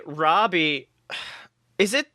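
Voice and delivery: usual speaking voice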